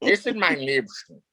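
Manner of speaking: hammed-up German accent, a quick imitation; bad German accent. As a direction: lightly play the bad German shopkeeper accent. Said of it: "I just dove into the whole German accent".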